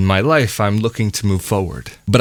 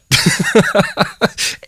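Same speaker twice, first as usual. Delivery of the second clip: amused laugh. Real, not acted. Laughs about superstition.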